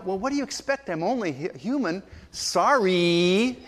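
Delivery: mock self-excusing apology; defensive excuse. Shown as a demonstration. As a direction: shrug off blame, then stretch the apology upward. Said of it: "ways our attitude can use the word sorry".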